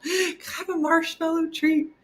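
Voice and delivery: put-on high little-kid request; high childish voice begging for a treat; five-year-old voice. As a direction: ask like an eager small child. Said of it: "like a five-year-old".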